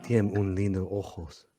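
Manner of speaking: mock Spanish dub. Dubbing the clip in Spanish.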